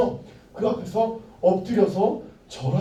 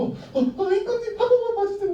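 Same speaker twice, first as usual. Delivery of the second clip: much higher, pleading whimper; mock begging; 12 semitones higher than usual. Condemned men pleading with the king for mercy, mock whine. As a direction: beg the king pitifully, hammed-up. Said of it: "그렇게 얘기를 한 게 아니라".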